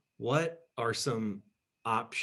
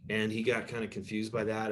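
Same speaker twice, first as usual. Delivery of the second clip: ordinary delivery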